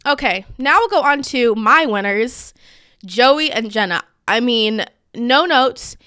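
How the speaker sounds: ordinary talk, nothing marked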